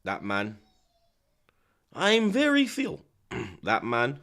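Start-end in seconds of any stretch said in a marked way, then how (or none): 1.9-3.0 high, slow mock Ukrainian accent. Streamer mimicking a boxer's catchphrase, Ukrainian accent. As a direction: push the imitation Ukrainian accent up high and stretch it. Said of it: "Did I say it in the right accent".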